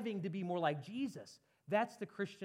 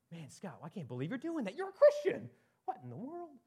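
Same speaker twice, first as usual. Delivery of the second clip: mock self-scolding; 4 semitones higher than usual. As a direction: scold yourself in hammed-up disbelief. Acts out inner self-reproach.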